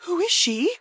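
high startled question; mock Victoria. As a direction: ask it high and taken aback. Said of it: "Victoria goes".

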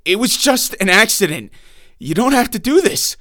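plead, voice rising in fear; begging. Acted. Bound man begs the intruder, frightened and rising.

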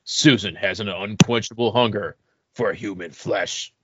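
dramatic movie trailer narration. Pretend. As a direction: read it like a blockbuster horror trailer. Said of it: "put on my best movie voice guy".

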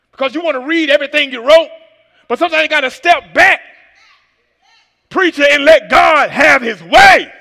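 building to a loud shout; preaching at full force. Acted.

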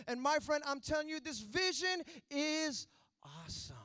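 loud, high-pitched revival preaching; preaching at full force. Acted. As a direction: drive the point home to the congregation.

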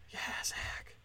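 hushed, whispered encouragement; whispered cheer. Pretend. Imagined listeners encouraging the host, whispered cheer. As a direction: whisper it like a faraway supporter.

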